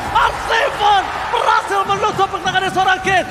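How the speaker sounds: excited shouting. Real, not acted. Caster yelling over a big play.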